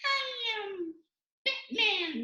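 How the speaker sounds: very high, squeaky superhero; squeaky Batman. Shown as a demonstration. Showing a hero nobody takes seriously, squeaky voice. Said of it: "if he had a squeaky voice".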